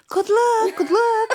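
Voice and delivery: high-pitched chirpy crowd imitation; mock well-wishers. Crowd wishing her luck.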